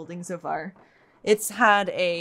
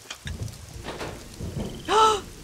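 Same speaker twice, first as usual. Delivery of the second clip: sudden breathless gasp of disbelief; sharp gasp; 14 semitones higher than usual. Real, not acted. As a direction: gasp as it finally works. Puzzle finally solved.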